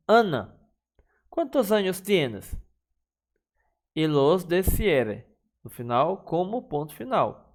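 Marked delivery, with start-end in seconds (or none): 0.1-2.5 higher, calling Ana then asking; question with a call. Shown as a demonstration. Teacher modelling a question mid-sentence, calling to Ana. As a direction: call her name and ask her age.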